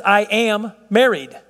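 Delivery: "I am married" said slowly, leaning on married; slow, weighted "I am married"; slow emphatic example. Shown as a demonstration. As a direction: draw out "married" to make the point. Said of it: "how about if we say this".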